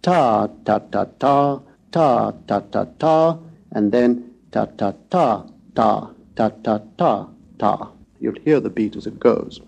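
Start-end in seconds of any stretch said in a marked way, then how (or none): 0.0-3.4 "ta" beaten out long-short-short-long; rhythmic long and short "ta" syllables; choriambic rhythm. Shown as a demonstration. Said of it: "it's mainly in choriambic". 4.5-7.9 "ta" beaten out short-short-long-long; measured short-short-long-long "ta" pattern; Ionic rhythm. Shown as a demonstration. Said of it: "Ionic a minori rhythms, like this".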